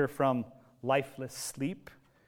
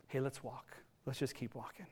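much softer, gentle and low-key; soft urging; 3 semitones lower, 14 dB quieter than usual. Retold or imitated. Jesus gently urging Jairus to keep walking, very soft. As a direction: coax him along quietly.

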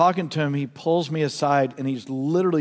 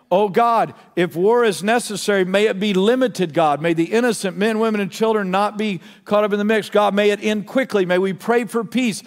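lifted, louder pleading prayer; fervent prayer; 5 semitones higher, 4 dB louder than usual. Acted. Pastor prays aloud for the congregation, fervent prayer. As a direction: cry out to God for peace.

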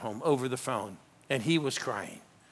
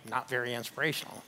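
low-key grumbled mutter, much softer; 3 semitones lower, 9 dB quieter than usual. Retold or imitated. Husband walking away from his wife, muttering. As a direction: mutter it under your breath as you walk off. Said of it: "husbands that walked away muttering".

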